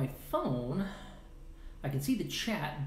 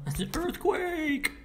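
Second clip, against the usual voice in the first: mock-panicked, much higher and louder, slowed; fake alarm; 5 dB louder, slower than usual. Joking to viewers about an earthquake, mock panic. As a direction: cry out in hammed-up alarm. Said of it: "No, I'm kidding".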